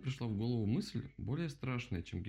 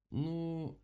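drawn-out, higher hesitation; hesitant; 7 semitones higher than usual. A narrator's reading. Rabbit unsure about freeing the owl, hesitant. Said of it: "замешкался кролик".

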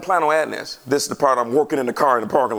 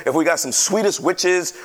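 normal talking voice

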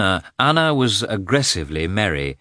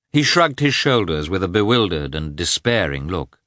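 plain reading voice

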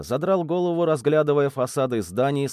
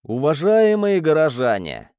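louder public address; calling to crowd; 3 semitones higher, 5 dB louder than usual. A narrator's reading. Hero hails the townsfolk around him, calling out. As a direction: call out to a gathering.

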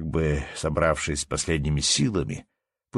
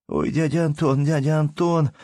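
tearful mumble; 10 semitones higher, 4 dB louder than usual. Acted. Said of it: "бормотал, заливаясь".

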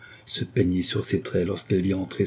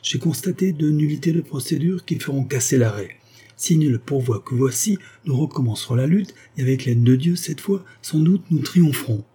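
higher, eager advocate urging her on; rising confident lawyer voice; young lawyer rallying; 6 semitones higher, 3 dB louder than usual. Acted. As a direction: push her to sign with hope. Maxou voiced by narrator.

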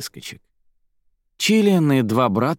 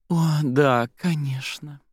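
higher, drawn-out show of agreement; put-on enthusiasm; 6 semitones higher, slower than usual. A narrator's reading. Zaira answers her rival with a fake smile, put-on enthusiasm. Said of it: "наигранно улыбнулась".

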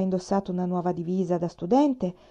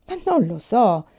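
ordinary delivery, nothing marked

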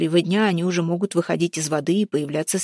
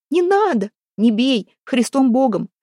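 high, wailing plea, rather loud; panicked pleading; 6 semitones higher, 4 dB louder than usual. A narrator's reading. Wife begs the men not to strike her, panicked pleading. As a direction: beg them off in a high cry.

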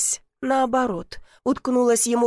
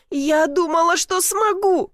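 tearful outburst; 7 semitones higher, 3 dB louder, slower than usual. A narrator's reading. Heroine unloading her pain on Dobrynya, tearful rising outburst. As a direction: pitch climbing high as she pours it out. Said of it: "продолжала выплёскивать всё наболевшее".

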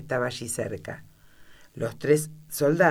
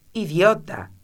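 snapped out, a touch louder; an impatient queen scolding, mildly voiced; impatient insult; 4 semitones higher, 6 dB louder, slower than usual. A narrator's reading. Queen to the jack of hearts, impatient and a little louder. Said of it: "moviendo la cabeza impacientemente".

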